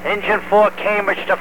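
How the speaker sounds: crackly two-way radio voice; calling in over a handheld radio; radio transmission. Real, not acted. Mutual aid company calling over radio.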